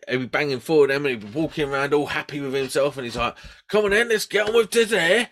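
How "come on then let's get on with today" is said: mock-upbeat, higher and louder rallying call; cheery coworker impression. Imitating the keen northern coworker, hearty rallying call. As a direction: ham up the keen colleague rousing the day.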